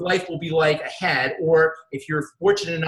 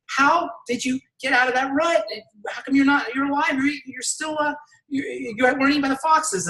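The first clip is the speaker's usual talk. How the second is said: high-pitched, stammering disbelief; astonished animals; 9 semitones higher than usual. Retold or imitated. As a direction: play amazed friends who can't believe their eyes. Astonished animals question frog in joke.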